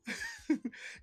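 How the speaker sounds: high hammed-up villain cackle; evil cackle. Mocking his old company, high evil cackle.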